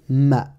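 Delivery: « ma » posé, m suivi d'un a; syllabe arabe. Shown as a demonstration. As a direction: prononce nettement « ma » pour l'élève. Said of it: "C'est la syllabe". (« ma » montré comme en leçon)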